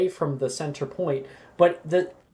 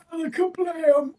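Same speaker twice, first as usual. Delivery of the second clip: mock spell shout; 11 semitones higher, 3 dB quieter than usual. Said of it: "he just goes".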